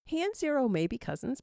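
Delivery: ordinary delivery, nothing marked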